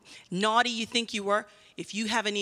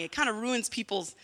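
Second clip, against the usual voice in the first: ordinary talk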